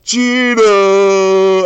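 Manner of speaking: high, very loud drawn-out stadium yell. Retold or imitated. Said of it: "I'm, like, yelling it".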